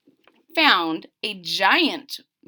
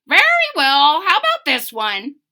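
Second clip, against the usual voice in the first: bird voice; 5 semitones higher, 8 dB louder than usual. Acted. Bird grants egg.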